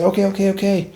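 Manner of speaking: high-pitched placating okays; hasty giving in. Retold or imitated. Soldier yielding to the mad sergeant, high placating voice.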